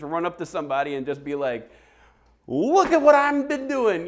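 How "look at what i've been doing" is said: very high, loud mock boast; brazen oversharing. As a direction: holler it out like a shameless oversharer. Mocking someone flaunting their sins.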